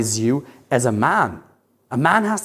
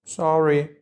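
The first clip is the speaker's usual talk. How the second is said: meek sorry. Retold or imitated. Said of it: "she goes from this to".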